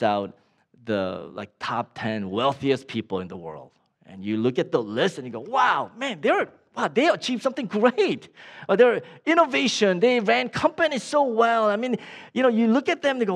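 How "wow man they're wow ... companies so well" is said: impressed onlooker. Acted. Onlookers admiring the rich list.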